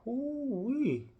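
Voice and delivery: high drawn-out Southern Ooh-wee, falling; a big Southern Ooh-wee; delighted Southern exclamation. Real, not acted. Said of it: "the southern accent's starting to come out".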